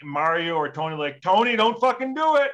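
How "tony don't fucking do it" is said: high, loud retold warning; imitating a friend yelling a warning. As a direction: call him off sharply.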